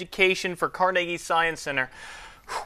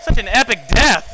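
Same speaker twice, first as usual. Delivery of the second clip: excited; 6 semitones higher, 11 dB louder than usual. Real, not acted. Reacting to the burning gummy bear.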